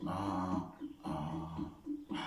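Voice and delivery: soft quiet A sounds. Shown as a demonstration. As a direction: drop the A to a soft hush. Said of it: "ale ciszej".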